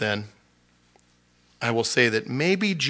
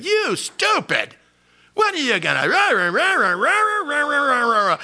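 high, loud mocking rant of an angry friend; Zophar ranting; 14 semitones higher, 6 dB louder than usual. Zophar yelling down at Job, a high mocking rant. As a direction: squeal it out like a blustering windbag. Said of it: "Zophar was really saying".

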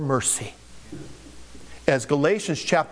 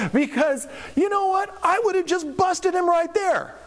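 much higher, worked-up rant about busting him; mock indignant outburst. Preacher imagines himself as a hotheaded Jesus, mock indignant. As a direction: get fired up like a hothead preacher. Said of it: "I would have been a bad Jesus".

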